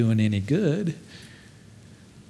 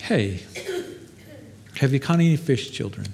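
soft, gentle, softer with a small laugh; tender call; 4 dB quieter than usual. Acted. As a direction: call them kindly like children. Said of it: "He said".

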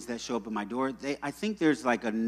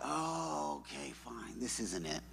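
deflated; 12 dB quieter, slower than usual. Retold or imitated. Backing down from confronting neighbours.

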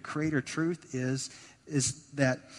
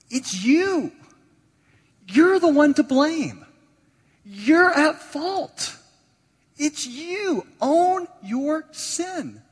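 Jesus's words shouted out much higher and louder; preached shout; 12 semitones higher, 10 dB louder, much slower than usual. Retold or imitated. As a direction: thunder the words out like a preacher calling people to own their sin. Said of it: "And Jesus is saying".

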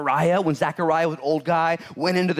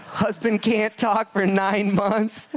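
laughing through the words, slow; can barely get it out for laughing; 5 semitones higher, much slower than usual. Real, not acted. Pastor joking to the church about a mute husband, laughing through it.

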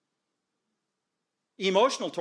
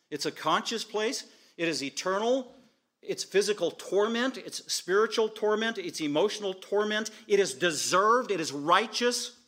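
high, loud, building hellfire proclamation; preaching at full force; 6 semitones higher, 5 dB louder than usual. Real, not acted. Preacher warning the congregation about eternal hell, loud and high. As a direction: hammer each word home from the pulpit.

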